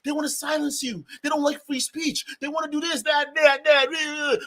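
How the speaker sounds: high-pitched mimicked griping; mock complaint. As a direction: parody them whining about being silenced. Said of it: "'cause y'all are saying".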